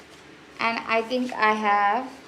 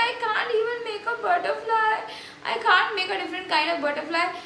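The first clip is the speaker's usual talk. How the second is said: high, fast, tearful whine; retold crying tantrum; 7 semitones higher, faster than usual. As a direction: replay the sobbing despair. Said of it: "I woke up and I was just crying".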